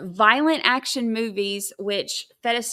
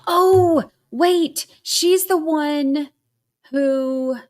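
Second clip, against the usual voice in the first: high and loud, caught by a thought; sudden realization; 6 semitones higher, 6 dB louder, much slower than usual. Real, not acted. Streamer suddenly remembering which housewife it is, surprised. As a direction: blurt it as it hits you.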